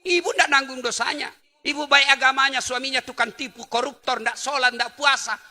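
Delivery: loud, high preaching at full force; fiery preaching. Acted. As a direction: drive it home to the congregation.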